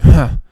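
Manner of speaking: a short loud huff with a shrug. Retold or imitated. Customer walking off defeated, a loud shrug huff. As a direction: huff it out and give up. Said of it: "a shrug huff".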